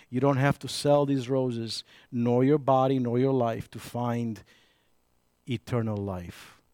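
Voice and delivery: tender plea. Retold or imitated. Gently urging girl in Romanian.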